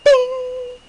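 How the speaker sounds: very high, loud imitated bell; voiced bell ding. A narrator's reading. Narrator imitates the oven bell from the back, bright ding. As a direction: ring it out like a kitchen timer bell.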